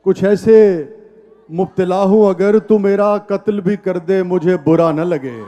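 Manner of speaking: measured, emphatic verse recitation; poetry recital. Acted. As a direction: recite it to a live audience. Recites ghazal at mushaira.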